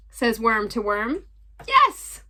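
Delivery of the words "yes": eager yes. Acted. Worm answers eagerly.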